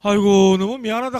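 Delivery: put-on an old man's loud heartfelt "aigo, so sorry"; apologetic old man. Pastor voicing the grandfather apologizing, loud and high. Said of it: "가니까 할아버지가".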